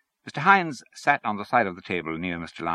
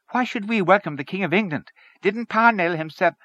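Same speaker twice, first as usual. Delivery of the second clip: higher, louder indignant questioning; indignant character voice; objecting story character; 11 semitones higher, 4 dB louder than usual. Acted. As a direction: challenge them with raised pitch.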